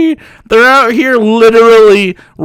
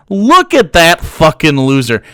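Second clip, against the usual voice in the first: normal talking voice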